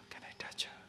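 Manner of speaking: breathy secret whisper, very soft; hushed tempted whisper. Acted. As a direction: lean in and whisper the wish. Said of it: "why that whispering coming?".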